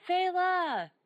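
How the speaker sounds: calling out Phala in the fog; yelling a name. Acted. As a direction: shout for a lost companion. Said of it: "I yell for Phala".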